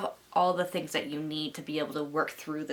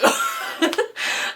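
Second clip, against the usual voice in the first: airy high giggle; real giggle; high laugh; 8 dB louder than usual. Real, not acted. Laughs after impression.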